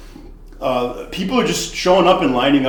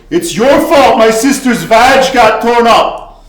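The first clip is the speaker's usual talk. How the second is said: slow, louder, higher enraged yell; furious yell; 10 semitones higher, 10 dB louder, slower than usual. Retold or imitated. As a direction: bellow the blame at him. Brother furiously blames bartender.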